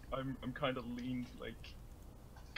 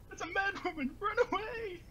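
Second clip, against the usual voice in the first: panicked shriek; 5 dB louder than usual. Acted. NPCs flee in panic.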